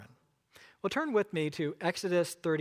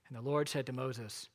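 scripture reading; 7 semitones lower, 7 dB quieter than usual. Acted. Pastor reads Exodus aloud.

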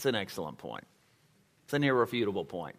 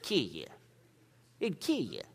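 Texas accent; 7 dB quieter than usual. Shown as a demonstration. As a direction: say He'd kill you the way they say it in Texas, "kill" clipped to "kih". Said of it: "That's how they say it in Texas".

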